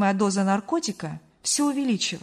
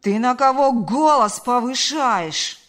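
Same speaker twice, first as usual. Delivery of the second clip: loud, drawn-out outraged shout; slow, loud, indignant retort; indignant yell back; 3 semitones higher, 8 dB louder, slower than usual. A narrator's reading. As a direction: yell back slowly, deeply offended. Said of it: "заорал он в ответ".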